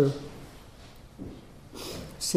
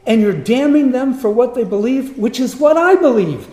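much higher and louder, pushing hard; indignant outburst; 8 semitones higher, 7 dB louder than usual. Retold or imitated. A patient's silent outrage at the pastor, loud and high. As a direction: throw the accusation back at him.

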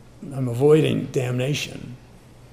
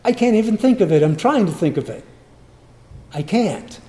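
high, loud, rushed fluster; a mock panic, fast, stumbling for words; hammed-up flustered answer to God; flustered pleading; 8 semitones higher, 4 dB louder than usual.

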